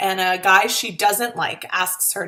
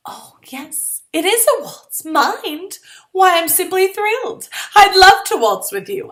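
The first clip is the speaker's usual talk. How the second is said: high, louder, sugary gush; gushing false delight; 10 semitones higher, 4 dB louder than usual. Acted. Girl pretending to be thrilled to waltz with him, gushing. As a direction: pour on fake delight. Speaking aloud, forced enthusiasm.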